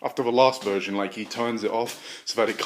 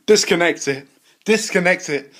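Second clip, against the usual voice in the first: shouted, higher command; loud order; 7 semitones higher, 9 dB louder than usual. Acted. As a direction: bark the order twice. Scientist ordering.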